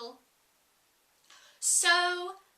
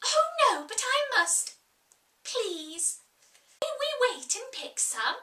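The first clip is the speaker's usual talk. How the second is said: much higher, eager begging; pleading; 10 semitones higher than usual. Acted. Alice begging the Sheep to stop the boat, high and eager. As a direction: beg like an eager child. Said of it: "Alice pleaded".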